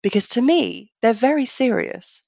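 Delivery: usual speaking voice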